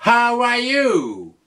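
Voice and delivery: How are you with high start, falling end; falling question. Shown as a demonstration. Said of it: "tu peux lui dire".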